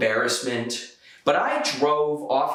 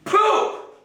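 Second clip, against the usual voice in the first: high loud startled yelp; shocked yelp; 5 dB louder than usual. Retold or imitated. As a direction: blurt it out in shock. Punchline outburst, high loud.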